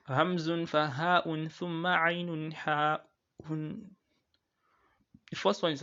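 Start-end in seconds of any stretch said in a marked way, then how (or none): 0.1-3.8 slow, deliberate chant of the Arabic line; recited verse. Shown as a demonstration. Teacher reciting the throat letters for the class, slow chant. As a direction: draw out each letter name as a recitation. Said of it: "It says".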